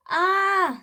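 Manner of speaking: loud, high open-mouthed yell. A narrator's reading. Annie screams after the boys at the bones, high yell. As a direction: scream "ah" well above speaking pitch. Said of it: "yelled Annie".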